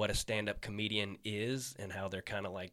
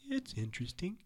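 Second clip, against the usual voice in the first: a hint of an indifferent Emo; 8 semitones higher, 5 dB quieter than usual. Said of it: "And he's like".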